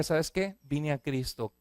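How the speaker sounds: a hint of fearful hesitance; timid confession. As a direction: confess it quietly, afraid of the reaction. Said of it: "que te dio miedo decirle a tu familia".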